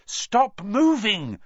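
slow high firm command; ordering. Acted. Hermione ordering the struggling boys, slow firm high command. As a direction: order them to freeze. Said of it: "Hermione ordered them".

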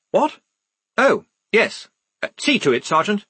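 high, startled, hesitant reply; bemused officer. Acted. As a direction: caught off guard, then hand it back to the sergeant. Bemused lieutenant caught off guard.

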